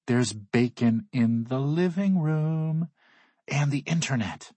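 excited. Acted. Excited friend shares hotel luxuries.

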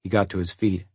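plain reading voice

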